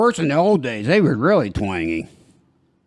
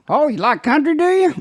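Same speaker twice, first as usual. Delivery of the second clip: much higher, teasing squeaky mock voice rising on "do you"; mock twangy reply. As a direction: tease the customer back in a hammed-up high voice. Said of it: "I talk back when they say".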